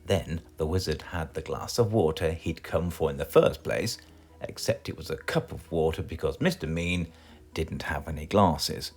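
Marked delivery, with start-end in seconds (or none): none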